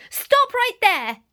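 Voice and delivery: high, loud, slow command; yell. Acted. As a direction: shout to stop the wolf. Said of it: "yelled Granny".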